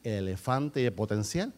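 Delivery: low, softened storytelling with pauses; relato solemne. Real, not acted. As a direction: bring it right down and tell it gravely. Preacher drops to hushed storytelling. (narración grave, baja y pausada)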